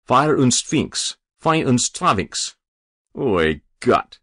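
put-on slow lament in a Yiddish accent; Yiddish-accented exclamation. A narrator's reading. Caretaker counting heads in the excitement, Yiddish-accented exclaiming.

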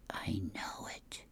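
slow unvoiced whisper; dog whisper. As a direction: breathe it like a secretive dog. The dog says.

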